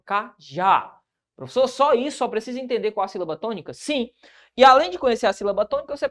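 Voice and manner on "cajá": "Cajá" split in two, landing on a long "já"; stress on last syllable. Shown as a demonstration. Teacher proving the last syllable is tonic, "Cajá" stressed on "já". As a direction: separate ca-já and lean on the final "já". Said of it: "A sílaba tônica é a última".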